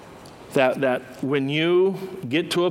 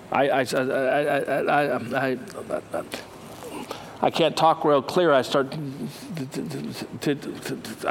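acted stammer. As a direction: stumble over the words nervously. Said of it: "start stammering".

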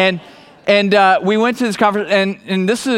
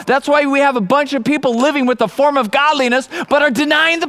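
fiery preaching; 8 semitones higher than usual. Acted. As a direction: preach it to the back row with full power. Preaches at full force.

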